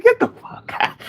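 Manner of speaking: high, breathless stunned whisper; mock disbelief. Telling a friend about the Dutch dog, stunned. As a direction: gasp it out in disbelief.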